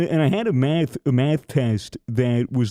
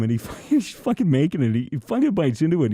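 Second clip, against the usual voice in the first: ordinary talk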